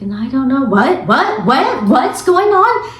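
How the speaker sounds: imitating sudden weirdness. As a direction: imitate her sudden confusion, climbing high at the end. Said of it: "And out of nowhere, she is like".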